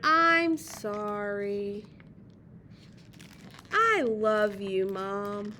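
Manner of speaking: put-on slow, low, sorrowful kid apology; sad apology. A narrator's reading. Reader voices sad David apologizing to Mom, slow low sorrowful voice. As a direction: draw it out low and contrite. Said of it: "He's sad, and he said".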